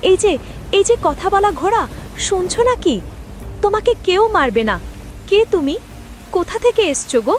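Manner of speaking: eager calling. Acted. Calling gently to the horse.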